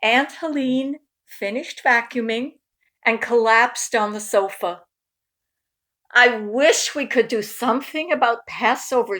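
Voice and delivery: lively storytime narration; reading to children. A narrator's reading. Parent reading a Passover picture book to kids, lively storytime. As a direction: read it out warmly to young listeners.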